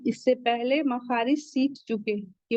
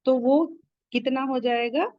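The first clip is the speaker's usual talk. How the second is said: ordinary delivery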